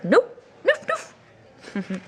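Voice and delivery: high noof falling away; Norwegian pig noof. Retold or imitated. Teacher imitating Norwegian children's pig sound. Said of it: "And they said".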